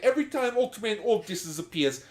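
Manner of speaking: high, loud Brooklyn dub voice; hammy Brooklyn wise guy; Brooklyn accent. Pretend. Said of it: "dub being like Joey from Brooklyn".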